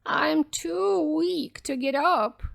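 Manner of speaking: put-on high, slow old-lady voice; weak granny calling out; frail grandmother. A narrator's reading. Grandmother telling the visitor to come in, frail and slow. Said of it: "said the grandmother".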